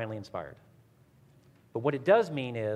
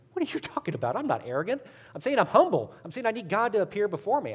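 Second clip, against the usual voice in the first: indignant protest; 7 semitones higher, faster than usual. Retold or imitated. Friend defending himself against being called arrogant, indignant. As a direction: imitate a friend protesting, pitch climbing higher.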